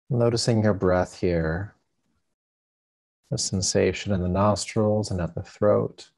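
calm, unhurried meditation guide with long pauses; guided meditation. Acted. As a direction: ease listeners into stillness, leaving space between phrases.

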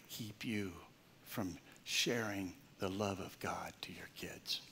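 hushed, slow whispered plea; soft whisper. Retold or imitated. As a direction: whisper it like a tearful child. Son's tearful words, retold.

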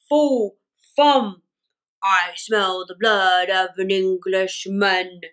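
loud chant, then low slow growl; booming giant. Acted. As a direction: boom it out like a giant. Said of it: "The giant cried".

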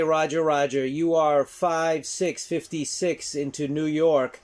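ordinary talk

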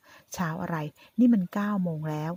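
plain reading voice

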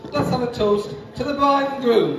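rally the room for a toast; wedding toast speech. Real, not acted.

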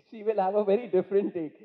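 ordinary talk, nothing marked

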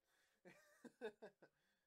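short burst of laughter; laughing. Real, not acted. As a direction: laugh at your own mistake. Laughs at himself.

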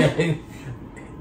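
short jittery laugh; nervous laugh. Real, not acted. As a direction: laugh out of nerves. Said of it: "That's a nervous laugh".

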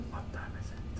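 barely audible whisper; tiny, unprojected voice; too quiet to hear. Shown as a demonstration. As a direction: whisper the English question. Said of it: "でも全然ダメです".

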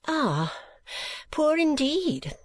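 high, drawn-out fussy matron; a scandalised old lady, very high; Mrs Nickleby. Acted.

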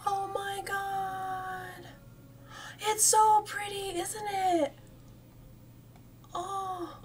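marveling aloud, breath held; high, slow awe. Real, not acted. Streamer gushing over the in-game ivy, high awed voice.

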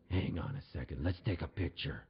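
low federale voice; mock tough cop. Federale stops him for a photo, low mock-cop voice. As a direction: drop low to play the cop.

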